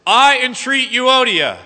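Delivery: slow, high, loud personal appeal; emphatic entreaty. Acted. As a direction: entreat one person directly, slowly and forcefully. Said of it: "In fact, what he says is".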